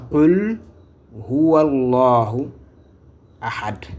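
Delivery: "Qul Huwallahu Ahad" slowly, with pauses between words; correct verse recitation. Shown as a demonstration. Teacher models the first verse for learners, slow correct recitation of "Qul Huwallahu Ahad". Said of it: "à la bonne prononciation".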